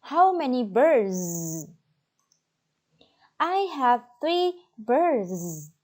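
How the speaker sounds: put-on slow and clear for a child; slow modelling voice. Shown as a demonstration. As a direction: model it slowly. Teacher modelling Q and A.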